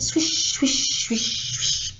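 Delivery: unvoiced airy swishes; hushed imitation of gusting wind; wind swishing. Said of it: "the wind in the trees outside went".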